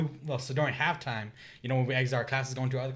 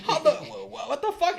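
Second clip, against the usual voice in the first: very high, loud, stammering through laughter; laughing disbelief; 5 dB louder than usual. Retold or imitated. Retelling meeting a girl who knew his friends, laughing in shock.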